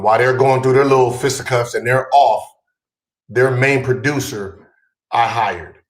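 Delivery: ordinary talk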